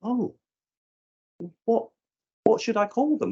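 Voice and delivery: high, taken-aback policeman; policeman's flustered reply. Retold or imitated. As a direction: play him caught off guard. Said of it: "And he just went".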